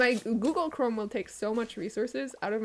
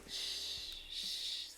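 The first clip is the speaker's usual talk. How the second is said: soft whispered shush; 14 dB quieter than usual. Real, not acted. Streamer sneaking into the stream unnoticed, whispered shush. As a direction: hush like sneaking in.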